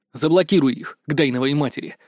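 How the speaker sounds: ordinary delivery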